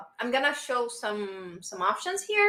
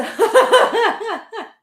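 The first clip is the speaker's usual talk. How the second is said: loud, high burst of laughter; big laugh; 10 semitones higher, 9 dB louder than usual. Real, not acted. Teacher amused by student's answer, big laugh. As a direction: crack up loudly, pitched high.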